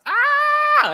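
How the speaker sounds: very high, loud scream; sudden shout. Retold or imitated. A boy suddenly screaming in a small therapy room. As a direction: let out a random piercing yell. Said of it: "started to shout".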